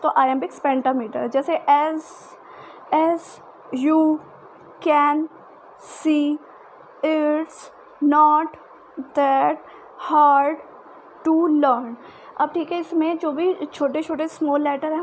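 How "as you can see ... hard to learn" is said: "as you can see, it's not that hard to learn" word by word, louder; slow word-by-word scanning, stressed words louder; iambic beat. Shown as a demonstration. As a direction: tap out each syllable of the line. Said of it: "scanned example line".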